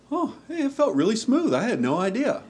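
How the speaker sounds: very high-pitched mock trainee voice; imitated new hire. Lieutenant quoting a new hire's compliment, high mock voice. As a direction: squeak it out as a put-on new hire. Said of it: "was from one of them".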